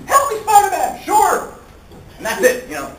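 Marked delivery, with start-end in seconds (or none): 0.1-1.0 high, loud cry for rescue; mock damsel in distress. Old-style girlfriend calling Spider-Man for help, mock damsel. 1.0-1.5 high, loud chirpy reply; eager mock hero. Spider-Man answering the girlfriend's cry for help, eager mock hero.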